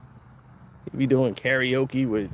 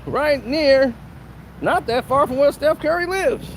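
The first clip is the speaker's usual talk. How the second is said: gleeful announcer; 10 semitones higher than usual. Retold or imitated. People telling Steph where housing goes, high gleeful tease. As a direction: deliver it like happy gossip. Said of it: "They was like".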